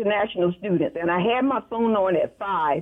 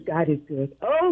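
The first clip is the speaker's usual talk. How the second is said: ordinary talk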